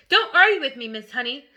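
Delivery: thundering. Acted. Headmistress thundering at Miss Honey, loud and commanding. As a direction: thunder it down at her. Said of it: "the headmistress thundered".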